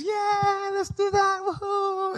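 put-on very high child voice; excited little girl. Four-year-old sister agreeing to play, high excited voice. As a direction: squeal it like a delighted small kid.

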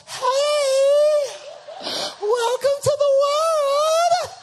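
put-on very high, loud, drawn-out baby greeting; cooing new parents. Smiling parents greet their crying newborn, exaggerated high cooing. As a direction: gush at a newborn in exaggerated singsong. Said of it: "we're smiling, talking about".

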